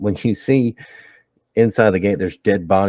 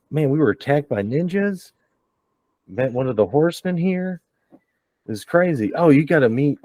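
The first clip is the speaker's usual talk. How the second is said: higher, breathless rush of news; excited chatter; 7 semitones higher than usual. Acted. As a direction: spill the story to a friend. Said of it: "Spyglass runs down and goes".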